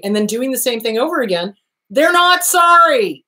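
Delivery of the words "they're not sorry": ordinary talk, nothing marked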